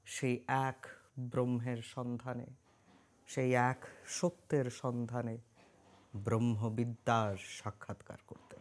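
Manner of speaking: slow, measured, ceremonial narration; solemn story prologue. Acted. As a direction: intone it slowly, with long pauses between phrases. Solemn scripted opening of the story.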